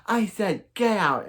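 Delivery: insist, shouting the order again; angry repeat. Retold or imitated. Laurel repeating her order at Sarah, high angry yell. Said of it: "she says".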